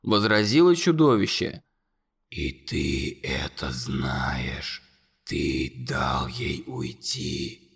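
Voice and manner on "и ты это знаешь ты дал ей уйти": very low, slow, whispered monster voice. A narrator's reading. Monster whispering accusations to Conor, low and slow. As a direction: loom over the boy and breathe every word.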